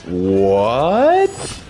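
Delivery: high, slow, stretched-out disbelief; shocked protest. Real, not acted. Streamer urging a viewer to visit Japan, shocked protest. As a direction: plead in a high, drawn-out voice.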